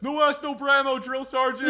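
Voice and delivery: loud high shouted pun to the sergeant; bellowed barracks joke; mock recruit shout. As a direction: yell it out like a cheeky recruit. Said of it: "somebody ... says".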